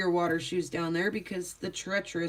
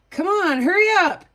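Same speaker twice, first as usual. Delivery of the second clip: put-on high, louder hurried mom calling her kid; a mom hurrying a dawdling child, raised and high; impatient mom; 10 semitones higher, 7 dB louder than usual. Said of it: "what I hear is a mom who's like".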